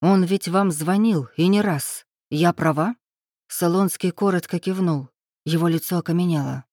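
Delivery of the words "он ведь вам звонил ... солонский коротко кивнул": ordinary delivery, nothing marked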